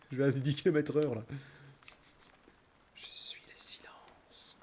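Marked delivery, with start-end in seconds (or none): 3.0-4.6 whisper. Pretend. Dramatic whispered line.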